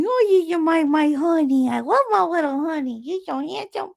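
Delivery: put-on high, cooing baby talk; animal baby-talk voice. Shown as a demonstration. Woman talking to her duck like a baby, high cooing voice. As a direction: coo sky-high as if to a pet. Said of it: "that's my animal voice".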